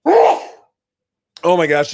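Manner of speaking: loud, drawn-out dad sneeze; big sneeze. Real, not acted. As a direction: let a huge sneeze rip. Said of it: "Uh-oh, I'm gonna sneeze".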